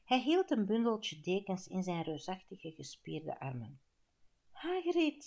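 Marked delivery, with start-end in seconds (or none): 4.5-5.2 high, loud burst of relief; relieved greeting. A narrator's reading. Perkamentus greets Hagrid arriving at last, relieved. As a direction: call out the name, pitched up and relieved. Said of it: "zei Perkamentus opgelucht".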